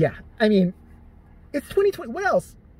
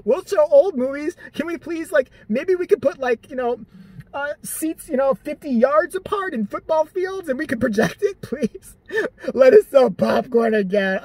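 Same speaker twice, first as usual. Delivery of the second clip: higher, whiny pleading as the theater chain; mock begging; 7 semitones higher than usual. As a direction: beg pathetically, pitched up. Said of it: "you guys are begging for money, like".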